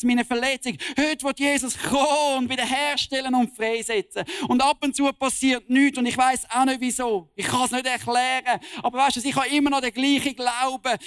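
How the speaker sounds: fiery preaching. Real, not acted. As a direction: preach it out with full conviction to the congregation. Preacher at full force. (kraftvolle Predigt)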